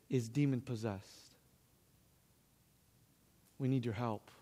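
low and very soft; grave, hushed plea. Retold or imitated. Aunt phoning nephew for help, grave and hushed. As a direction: break it quietly, sinking in pitch.

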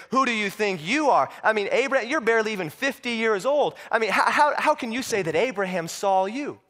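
high-pitched, indignant questioning; incredulous challenge. Acted. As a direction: challenge him in acted disbelief. Leaders challenge Jesus's claim.

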